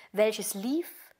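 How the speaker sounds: usual speaking voice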